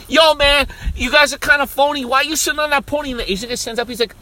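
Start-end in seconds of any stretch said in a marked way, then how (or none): 0.1-3.1 high, loud swaggering call-out; mock gangster. Fake gangster to guy on pony, loud high bluster. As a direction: call them out like a pretend rapper. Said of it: "I was a legit gangster".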